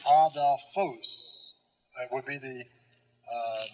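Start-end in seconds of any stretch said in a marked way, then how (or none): 0.1-1.6 adelphos very slow, ah-thel-FOHS; stress on last syllable. Shown as a demonstration. Teacher giving the pronunciation of that word, "adelphos" stressed on its end. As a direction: stretch "adelphos" out, with weight on the final syllable. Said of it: "the pronunciation of that word".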